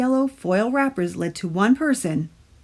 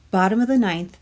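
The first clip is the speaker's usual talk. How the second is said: ordinary delivery, nothing marked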